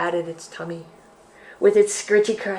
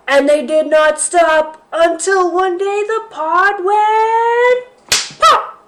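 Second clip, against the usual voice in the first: rising higher and louder to pop; building excitement; 11 semitones higher, 9 dB louder, slower than usual. Acted.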